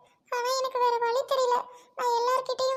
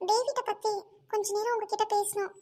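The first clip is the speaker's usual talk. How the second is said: usual speaking voice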